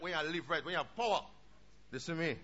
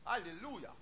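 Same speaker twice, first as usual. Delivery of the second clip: ordinary delivery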